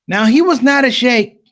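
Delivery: normal talking voice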